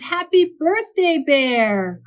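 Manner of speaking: high, loud birthday greeting; cheerful squeaky shout to a grumpy friend; happy cry. Acted. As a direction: call it out brightly like an eager little mouse. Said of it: "cried Mouse".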